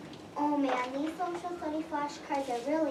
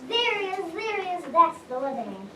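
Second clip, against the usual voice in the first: excited; 3 semitones higher, 6 dB louder than usual. Acted. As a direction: shout it out pointing. Child accuses excitedly.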